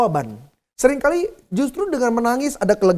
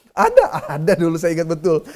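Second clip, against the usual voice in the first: laughing; 4 semitones lower than usual. Real, not acted. Laughing at own burnt-food joke.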